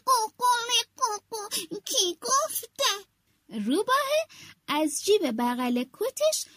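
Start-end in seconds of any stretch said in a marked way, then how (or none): 0.1-2.9 rooster puffing up, a bit nervous. Acted. Rooster telling the fox to step back, high and stammering. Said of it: "خروسه گفت".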